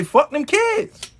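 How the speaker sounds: high, slow and pointed; mock-defiant slow outburst; defiant declaration. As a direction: spell it out like a bold vow. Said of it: "you gotta say".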